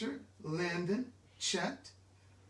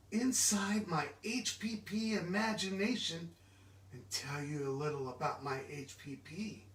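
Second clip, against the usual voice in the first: plain reading voice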